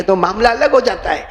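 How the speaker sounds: ordinary talk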